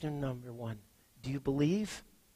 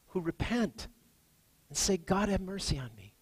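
raised, ringing preacher's voice on grace; impassioned preaching; 6 semitones higher, 4 dB louder than usual. Acted. Pastor urging sinners to the open table, full-force preaching. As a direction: preach it out, high and strong.

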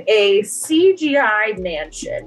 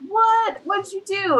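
1 shocked crowd; 10 semitones higher than usual. Retold or imitated. Crowd reacts to Neil's act.

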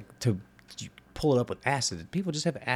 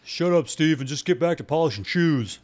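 louder, higher put-on voice; dismissive coworker; 6 semitones higher, 4 dB louder than usual. Coworker sends Steve back to polishing shoes, loud dismissive character voice. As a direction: shut him down as a gruff-minded workmate.

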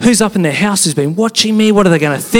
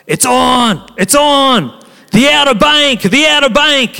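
higher, hyped call that the surf is on; excited surfer mates; 8 semitones higher than usual. Retold or imitated. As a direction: pump it up like mates spotting big waves. Surf mates hyping the waves.

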